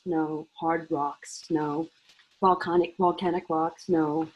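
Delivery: usual speaking voice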